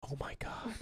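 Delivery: a whispered gasp of awe. Retold or imitated. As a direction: breathe it out in a whisper. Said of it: "She was like".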